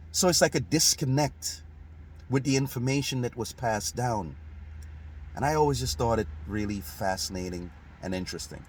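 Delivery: ordinary delivery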